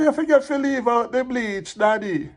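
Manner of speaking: put-on high-pitched little boy calling Daddy; child's voice. Little boy passing on Mavis's message to Daddy, childlike. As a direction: lift it high like a child on the phone.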